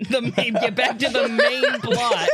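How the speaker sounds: loud, high, laughing cry; laughing exclamation. Real, not acted. Game master hurrying back to the plot, laughing loudly.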